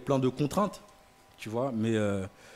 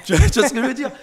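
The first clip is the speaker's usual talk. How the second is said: laughing high and loud; giggling through the question; 12 semitones higher, 12 dB louder, faster than usual. Real, not acted. Laughs at influencer boast.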